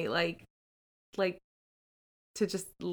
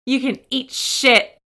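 louder mock snap, mom losing it; mother snapping at son; 4 semitones higher, 9 dB louder than usual. Exhausted mother snapping at her hungry son, loud mock snap. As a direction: fling it at the kid, fed up. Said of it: "She's like".

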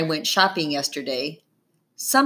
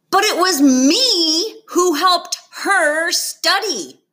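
much higher and louder, slow and indignant; outraged; 11 semitones higher, 5 dB louder than usual. A narrator's reading. Girl protesting her friend beat her, outraged. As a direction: protest it, wounded and furious. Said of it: "outrage in her voice".

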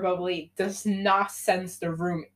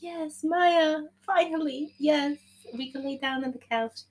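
excited cheer; 8 semitones higher than usual. Retold or imitated. Layson overjoyed.

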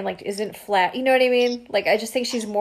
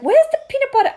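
"Where's the peanut butter?" much higher and louder, hammed-up foreign accent; a hammed-up try at a foreign accent, pitched way up; attempted accent; 5 dB louder, faster than usual. Imitating the mom in the kitchen, a hammed-up accent. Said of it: "I can't even do her accent".

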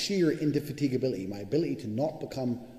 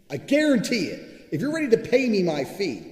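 emphatic shout; 9 semitones higher, 7 dB louder than usual. Real, not acted. Vowing to doubters he will deliver, shouting. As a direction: promise it loudly. Ranting about getting paid.

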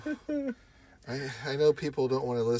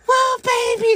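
much higher and louder breathless cry; high swooning woman; 12 dB louder than usual. Retold or imitated. Retelling a movie scene, a woman swooning in a high loud cry. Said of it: "she's like".

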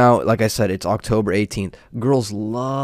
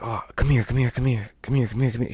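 imitating neighbor beckoning; 6 dB quieter, faster than usual. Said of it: "She's like".

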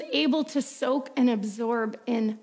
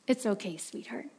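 soft, hushed reassurance; father calming his daughter at bedtime; gentle father; 7 dB quieter than usual. Acted. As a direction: soothe her like a loving dad.

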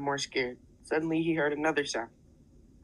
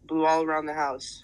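usual speaking voice

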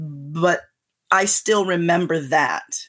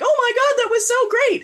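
much higher, gushing delight; gushing excitement. Retold or imitated. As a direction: squeal it like a thrilled teen. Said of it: "they're like".

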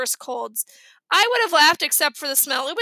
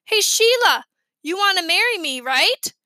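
calling out; 5 semitones higher, 5 dB louder than usual. Acted. Fudge calling across porch.

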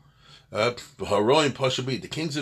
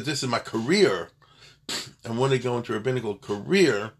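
"career" raised in pitch and leaned on; stress on career. Shown as a demonstration. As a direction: punch the word "career" each time. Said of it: "with the emphasis on the word career".

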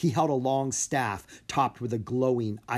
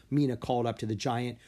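ordinary delivery, nothing marked

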